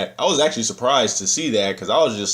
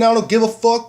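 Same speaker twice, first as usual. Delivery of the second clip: high, raised-voice imitation of a screaming fan; mock scream; 12 semitones higher, 5 dB louder than usual. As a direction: holler it like a rowdy fan, half-strength.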